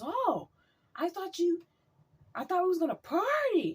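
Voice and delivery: high, eager party-boy imitation; imitating excited guy. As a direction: play him high-pitched and eager to party. Acted misunderstanding of the party.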